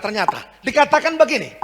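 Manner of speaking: ordinary talk, nothing marked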